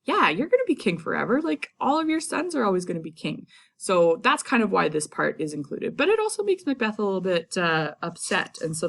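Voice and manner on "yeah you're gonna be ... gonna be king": fast, high, gushing flattery; mock brown-nosing. Shakespeare flattering King James, gushing and quick. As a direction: suck up to the king eagerly. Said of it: "brown-nosing up to the king".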